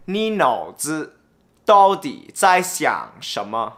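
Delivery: usual speaking voice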